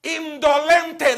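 indolente drawn out slow and firm; slow emphatic word. Shown as a demonstration. Preacher teaching congregation the word, slow stress. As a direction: lay out indolente slowly, syllable by syllable. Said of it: "la palabra que Dios me dio es".

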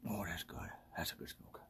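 barely voiced, very soft murmur; hushed smoker's aside. Real, not acted. Solo host savouring a good smoke, hushed. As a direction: let it out in a whisper on the exhale.

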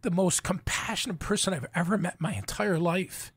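real tearful voice, high onset then softening; choked up. Real, not acted. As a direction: fight back tears with halting pauses. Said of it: "It's all right".

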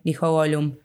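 plain reading voice

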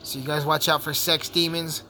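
ordinary talk

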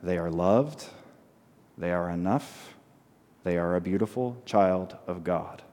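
preacher modelling a quiet blessing for the congregation; soft affirmation. Shown as a demonstration. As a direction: say it softly to the other person. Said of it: "look at that person and say".

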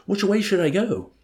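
very high, loud, furious cry; anguished high-pitched demand; suffering high-pitched shout. A narrator's reading. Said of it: "in a suffering, high-pitched voice".

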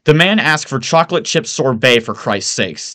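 loud, high incredulous outburst; wailing disbelief. A narrator's reading. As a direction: wail it as if it's unbelievable. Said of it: "Timothy wails, disbelieving".

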